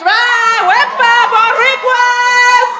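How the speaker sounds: high, loud festive whoop; Puerto Rican cheer. Pretend. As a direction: whoop proudly to the crowd, last word stretched.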